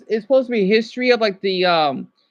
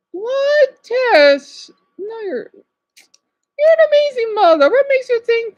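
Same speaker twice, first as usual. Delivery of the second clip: high-pitched, incredulous reassurance; disbelieving protest; 13 semitones higher, 5 dB louder, slower than usual. Real, not acted. Streamer reassuring a mother who doubts herself, high disbelief. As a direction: protest in a high voice, stretching the praise.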